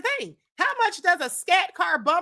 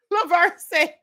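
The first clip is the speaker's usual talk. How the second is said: louder, slow, words broken by laughter; laughing through words; 3 semitones higher, 9 dB louder, slower than usual. Real, not acted. Host reading a viewer's name while laughing hard. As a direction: try to read the name but keep cracking up.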